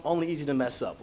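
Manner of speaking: normal talking voice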